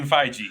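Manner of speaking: "Feige" with a soft G, like "fai-jee"; "Feige" mispronounced with a j sound. Real, not acted. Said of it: "What's with you and that soft G?".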